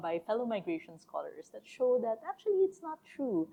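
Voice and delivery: usual speaking voice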